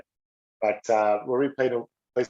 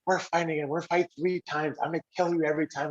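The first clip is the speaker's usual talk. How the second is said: high-pitched mock menace; retold threat, pitched up; threatening rival; 6 semitones higher than usual. Rival's retold threat in penalty box.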